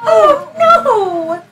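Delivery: mock dismay. Dismay at broken broom.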